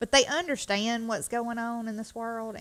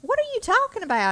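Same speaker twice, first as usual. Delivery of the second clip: much higher and louder, shocked; startled reply; 13 semitones higher, 6 dB louder than usual. Retold or imitated. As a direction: blurt it out, caught off guard. Mother retells startled reply to son.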